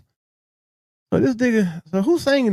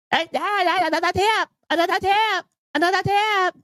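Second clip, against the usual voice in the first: mock catchphrase; 9 semitones higher than usual. Parroting the video again.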